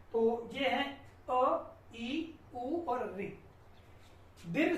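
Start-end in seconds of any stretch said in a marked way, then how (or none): 1.3-3.2 vowels said one by one, slowly; slow short vowels. Shown as a demonstration. Teacher slowly naming the short vowels. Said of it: "ह्रस्व मतलब जिसमें उच्चारण में बहुत कम समय लगता".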